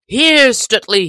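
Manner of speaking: high, loud call to Stutely; crying out. A narrator's reading. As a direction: call out across the fight. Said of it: "he cried".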